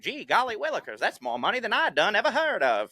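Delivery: mock folksy amazement, high swooping pitch; hammed-up yokel marveling; awestruck bumpkin. Mimicking awestruck folksy listeners.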